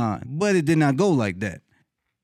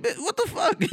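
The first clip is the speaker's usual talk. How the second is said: very high, laughing mock outrage; incredulous comeback pitched way up; indignant disbelief. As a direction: squeak it out, comically affronted. Retelling reaction to rude passersby.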